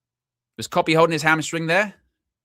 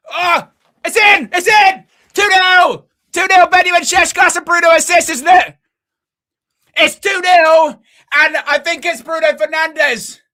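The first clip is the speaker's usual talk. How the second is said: much higher and louder, shouting with joy; goal celebration; 12 semitones higher, 9 dB louder than usual. Real, not acted. Fan watching his team score, ecstatic real shouting. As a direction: scream the goal like a delirious fan.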